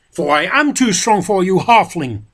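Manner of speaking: loud, high roar; shouting. A narrator's reading. Boromir shouting at Frodo as he attacks. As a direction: bellow it as he leaps. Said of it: "he shouted".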